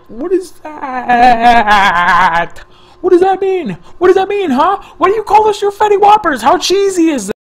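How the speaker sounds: high loud scoffing; mocking disbelief. Real, not acted. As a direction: ridicule the silly nickname. Mocking the nickname.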